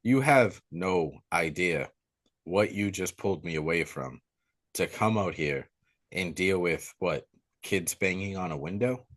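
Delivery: low, quiet, measured reprimand; calm, annoyed sheriff. Retold or imitated. Sheriff scolding Nancy for calling him out, calm. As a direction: drop low and soft, quietly fed up. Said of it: "he's calm about it".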